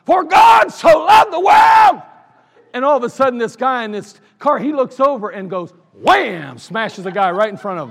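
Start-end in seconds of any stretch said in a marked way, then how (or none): 0.1-2.0 booming preacher's cry, very high and loud, slow; street preaching shout. Retold or imitated. Preacher bursting out on a downtown street, booming shout. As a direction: bust out preaching at full force. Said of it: "starts going".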